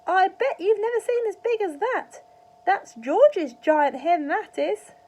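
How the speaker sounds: put-on high, loud old woman's shout; shrill old-granny yell from the roof; Grandma shouting. A narrator's reading. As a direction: bellow it down from the rooftop like a cackling granny. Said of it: "Grandma shouted".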